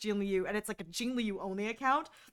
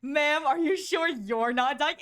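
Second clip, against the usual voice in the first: mock concern; 5 semitones higher, 5 dB louder, slower than usual.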